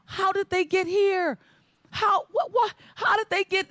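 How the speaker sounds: high, rattled stammer of shock; can't believe the family showed up; shocked disbelief. Retold or imitated. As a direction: sputter in disbelief. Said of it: "I'm like".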